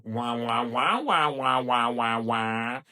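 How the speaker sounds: muted trombone wah wah; droning wah wah noise; Charlie Brown adult. Retold or imitated. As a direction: imitate the muffled grown-up voice. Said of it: "I go back to Charlie Brown".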